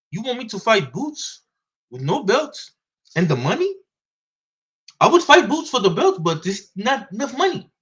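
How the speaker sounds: lightly voiced German accent, like a European boxer; Katz's German accent. Katz telling me off the record about Boots, in a light German accent. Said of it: "In, in his German accent".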